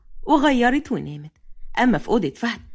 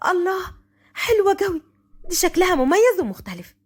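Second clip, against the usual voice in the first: put-on higher-pitched, girlish wonder; delighted admiration; 6 semitones higher than usual. Wateen admires the filly. (بانبهار)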